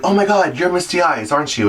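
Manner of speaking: high, excited fangirl gasp; gushing fan. Retold or imitated. A stranger at a bar gushing over a drag queen, high and excited. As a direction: act out a starstruck stranger recognizing you. Starstruck fan interrupts a date.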